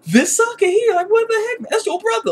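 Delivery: very high-pitched mock disbelief; incredulous reaction. Fan reacting to a brother's betrayal, high mock disbelief. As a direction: squeal it in exaggerated disbelief. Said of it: "I'm like".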